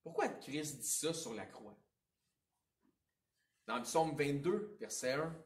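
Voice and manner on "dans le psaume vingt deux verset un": ordinary delivery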